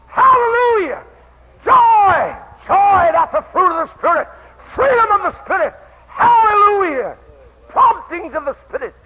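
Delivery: loud, high shouted praise; preaching at full force. Real, not acted. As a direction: cry it out to the congregation.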